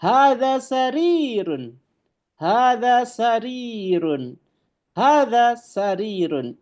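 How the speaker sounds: slow, high, careful Arabic recitation of "Hadza babun"; Arabic model reading. Shown as a demonstration. Teacher reading Arabic to students, slow and emphatic. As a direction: drawl out "Hadza babun" for the class to copy. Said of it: "Ini adalah sebuah ranjang".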